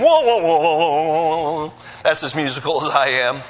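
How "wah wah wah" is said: slow wobbling wah wah wah; slow mock sad trombone with wobble. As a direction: sing a droopy trombone fail sound. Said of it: "that wobbles at the end".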